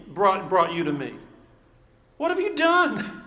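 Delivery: high, fast, exasperated questions; exasperated Pilate. Retold or imitated. Pilate to Jesus, baffled by the charges, exasperated and high. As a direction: throw up your hands, pitch climbing. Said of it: "he's exasperated".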